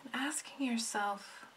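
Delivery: soft, unhurried meditation voice with long pauses; hushed, soothing meditation lead; guided meditation. Acted. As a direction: gently guide them inward, letting each phrase settle.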